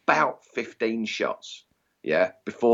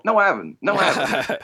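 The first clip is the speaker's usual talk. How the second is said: mock disappointment; 10 semitones higher than usual. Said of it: "and I thought".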